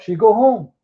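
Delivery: ordinary delivery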